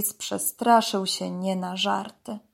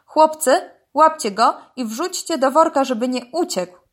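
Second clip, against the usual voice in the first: loud, higher call to the squad; shouted command; 5 semitones higher, 5 dB louder than usual. A narrator's reading. Police crocodile orders officers to seize python, shouting. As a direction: call out orders briskly to your men. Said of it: "krzyknął".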